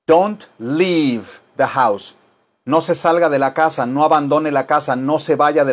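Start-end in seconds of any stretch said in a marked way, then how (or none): 0.1-2.2 slow, careful English; slow teaching read; very slow model. Shown as a demonstration. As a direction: say it very slowly for learners.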